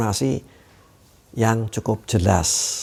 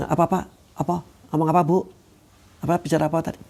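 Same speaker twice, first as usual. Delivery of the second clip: higher, puzzled repeated asking; confused asking; 5 semitones higher, much faster than usual. Retold or imitated. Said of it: "Terlalu lemah, saking halusnya".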